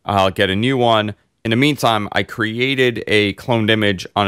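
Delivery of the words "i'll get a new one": ordinary delivery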